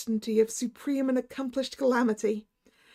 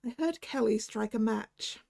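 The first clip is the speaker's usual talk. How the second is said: usual speaking voice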